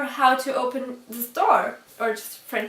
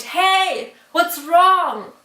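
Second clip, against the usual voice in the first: a neighbour calling across, higher and louder; retold shout; 6 semitones higher, 6 dB louder, much slower than usual. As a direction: call out from a far balcony. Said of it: "He screamed".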